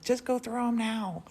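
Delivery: mildly exasperated retold urging; mock impatient urging. Morton urging her to throw out mom's boxes, impatient imitation.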